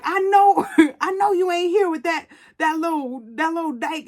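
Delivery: imitating an indignant husband, high-pitched; mock-outraged, high and stumbling; confronting husband. Husband confronts wife at motel.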